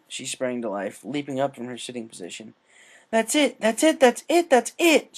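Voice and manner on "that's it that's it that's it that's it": much higher and louder, building shouts; excited. A narrator's reading. Rainbow realises she can run the race, excited shouting. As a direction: burst out with a sudden idea. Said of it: "she exclaimed excitedly".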